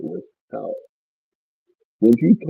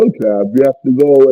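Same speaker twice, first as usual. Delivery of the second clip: ordinary delivery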